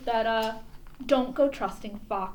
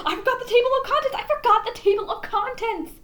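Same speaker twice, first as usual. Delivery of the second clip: high, loud, fast panic; frantic cry; 10 semitones higher, 5 dB louder, faster than usual. A narrator's reading. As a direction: blurt it out in a rush. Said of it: "cried Jack".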